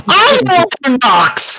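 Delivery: high ghostly wail; screaming. Pretend. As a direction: wail it like a haunting. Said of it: "I really hope you scream like that".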